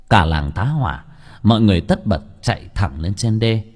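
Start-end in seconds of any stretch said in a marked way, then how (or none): none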